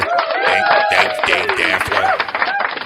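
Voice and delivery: squeaky mock thank-you voice; silly high voice.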